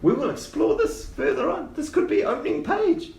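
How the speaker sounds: very high, thrilled little voice; excited gnome. Acted. Gnome plans an opening page, excited high voice. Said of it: "yeah, he says".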